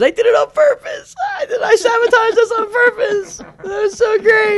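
very high, loud mock-hysterical squeal; imitating laughing confession. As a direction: imitate someone helpless with laughter while confessing. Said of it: "he just starts laughing. He goes".

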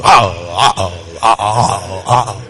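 very low, repeated woofs; imitated dog barking. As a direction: woof slowly like a big dog. Imitates the dog's answer.

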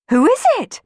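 calling out. Acted. Children calling to the hidden voice, loud high call. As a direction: call out toward the chimney. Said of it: "called Juliet and Johnny together".

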